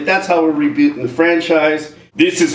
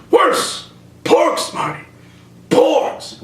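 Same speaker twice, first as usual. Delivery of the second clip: high, heavy dramatic reveal; grave Doc Brown; 12 semitones higher, much slower than usual. Acted. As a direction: land each word like doom. Doc reveals porgs in skit.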